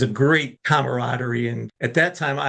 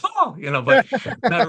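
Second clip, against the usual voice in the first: very high falsetto "oh"; mock everyone's squeaky voices; exaggerated high-pitched imitation; comic high voice; 9 semitones higher, 3 dB louder than usual. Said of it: "Everyone's got a high voice in my world".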